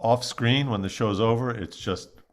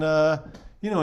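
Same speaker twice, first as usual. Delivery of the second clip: ordinary talk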